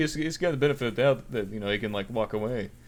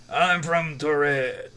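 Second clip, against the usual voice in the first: slow, deliberate put-on voice; little guy, slowly; much slower than usual. Traveler answering airport officials in a slow put-on voice. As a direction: say it slow and odd, like a stranger. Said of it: "he's like".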